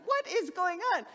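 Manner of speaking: a touch of startled confusion; bewildered husband. Retold or imitated. Husband woken by wife's screams, bewildered.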